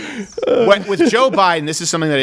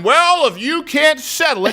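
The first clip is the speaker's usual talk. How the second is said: mock mediator; 11 semitones higher, slower than usual. U N lecturing both sides like the teacher, high mocking scold. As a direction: imitate a useless arbiter with exaggerated pitch. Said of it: "No, that's the U N".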